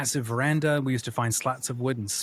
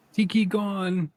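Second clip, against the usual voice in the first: put-on little kid; 8 semitones higher, much slower than usual. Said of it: "is what apparently I said".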